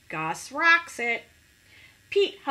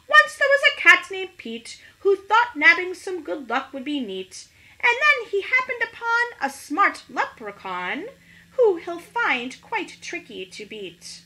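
rhyme; 7 semitones higher, 6 dB louder than usual. Acted. Leprechaun recites a rhyming taunt.

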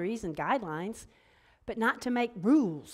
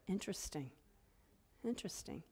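soft musing; 13 dB quieter than usual. Real, not acted. Muses softly on the verse.

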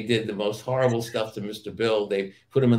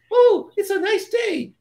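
very high, loud little clay-figure voice; Mister Bill voice; 6 dB louder, slower than usual. Retold or imitated. Guest imitates Mister Bill greeting his tormentor, very high and loud. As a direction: squeak it out like the helpless clay man. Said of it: "he talked like that voice".

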